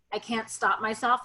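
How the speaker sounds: ordinary talk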